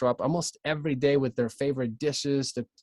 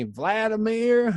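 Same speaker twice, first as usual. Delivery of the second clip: Vladimir drawn out the East Tennessee way, slow and higher; Southern Vladimir; 10 semitones higher, 5 dB louder, much slower than usual. Retold or imitated. Recalling how Tennesseans said his name, a Southern Vladimir. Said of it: "That is how we say Vladimir".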